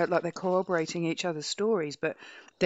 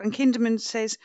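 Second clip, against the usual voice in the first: ordinary talk, nothing marked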